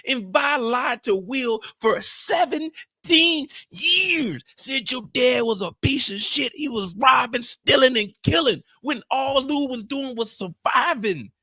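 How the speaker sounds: high, slow, outraged shouting; furious rant. Real, not acted. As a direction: hammer each accusation.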